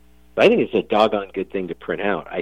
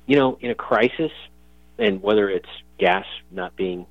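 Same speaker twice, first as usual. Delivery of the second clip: usual speaking voice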